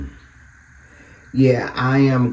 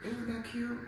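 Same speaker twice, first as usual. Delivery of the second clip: delighted high coo; 12 semitones higher, 15 dB quieter than usual. Real, not acted. Showing off outfit again.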